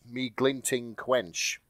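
slow, weighty reading of the name; dramatic reveal. Shown as a demonstration. Reading the villain's name to the hosts, slow and ominous. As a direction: read out each word like a doom-laden omen. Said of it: "tremble before you as you read the letters".

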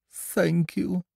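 high little voice, no real growl; Pooh's thanks. A narrator's reading. Pooh receiving his present, high small voice. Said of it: "growled Pooh".

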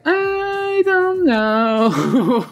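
very high, hugely drawn-out whine; mock helpless bawling; hammed-up wail. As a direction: stretch it into a squealed, breaking-down wail. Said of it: "And she's like".

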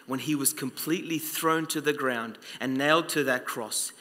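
preacher softly recounting the crucifixion; hushed solemn. Real, not acted. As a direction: let it sink to a hush over the cross. Solemn passage on the cross.

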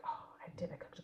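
soft whispered aside. Retold or imitated. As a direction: whisper it like a little secret. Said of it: "I was like".

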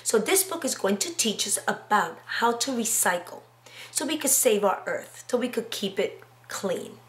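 usual speaking voice

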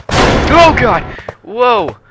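high, loud shriek of fright; terrified scream. Real, not acted. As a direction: yelp in sudden panic. Another jump scare.

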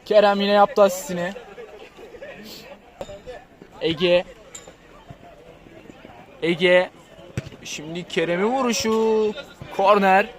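usual speaking voice

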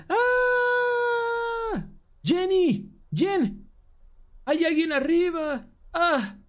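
high, frightened cries of her friend's name; panicked shriek. Acted. Girl calling up to Jen about an intruder, high panicked shriek. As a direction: shriek for Jen as if someone is upstairs.

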